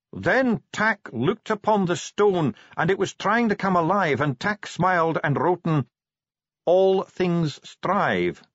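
high, drawn-out proclamation. A narrator's reading. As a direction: project each slow phrase over a commotion. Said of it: "raising his voice above the growing commotion".

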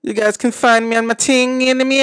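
loud, high Jamaican put-on; hammed-up Jamaican lilt; mock Jamaican accent. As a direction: plug yourself in an exaggerated Jamaican accent. Carries on the Jamaican accent bit.

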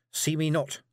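ordinary delivery

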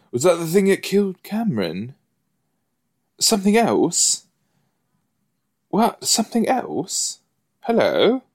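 high-pitched mock British accent; imitated British accent. Viewer echoing a character who sounded British for a second, mock British accent. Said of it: "He sounded British for a second".

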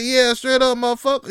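high, loud hyped agreement. Retold or imitated. Agreeing with your friend, loud hype. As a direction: back them up at full volume. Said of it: "It's like".

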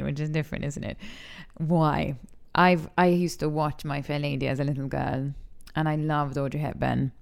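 ordinary delivery

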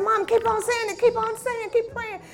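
urgent urging. Retold or imitated. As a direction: push her on, urgently. Son urging mother mid-prayer.

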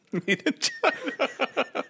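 real laughter while echoing the joke; laughing. Real, not acted. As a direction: squeeze the words through laughter.

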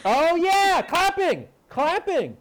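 high, loud excited calls; eager, animated agreement; bouncing with recognition. Real, not acted. Recognizing friend's gesture excitedly.

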